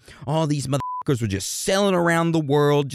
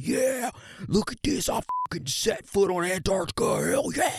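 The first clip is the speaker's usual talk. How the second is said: high, strained, softer crowing voice; hammed-up boastful squeal; bragging explorer; 8 semitones higher, 5 dB quieter than usual. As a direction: brag in a silly high-pitched voice. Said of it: "began bragging about it".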